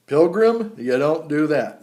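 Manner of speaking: loud, slow mock-stern scolding; stern warning. Warning viewers not to swallow chew, loud and mock-stern. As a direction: wag a finger at the greenhorn.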